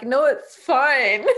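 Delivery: drawn-out, laughing through tears; mock-casual laugh through tears. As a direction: brush it off while half laughing, slowly. Retelling crying in front of partner.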